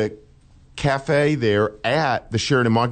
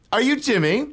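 high-pitched mock Cockney woman; hammed-up English accent; bad English accent; 13 semitones higher than usual. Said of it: "a bad English accent".

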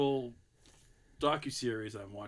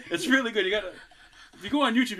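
normal talking voice

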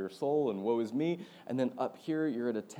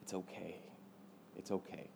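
soft reassurance; 4 semitones lower, 13 dB quieter than usual. Retold or imitated. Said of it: "God was just saying".

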